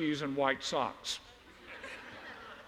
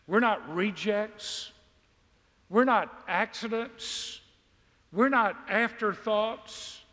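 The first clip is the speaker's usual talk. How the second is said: emphatic preaching; 4 semitones higher, slower than usual. Acted. Preaching build on repeated lines.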